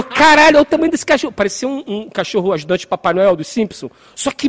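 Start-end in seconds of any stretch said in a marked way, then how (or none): 0.0-1.3 high, loud retold astonishment; loud mock shock, pitched up; shocked exclamation. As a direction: blurt it out, amazed at the size. Retelling friend's shocked reaction to dog.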